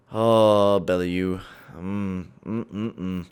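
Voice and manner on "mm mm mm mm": low, very soft humming groan; pained groan. Real, not acted. Gamer groaning quietly at the injury news. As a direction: moan in dismay under the breath.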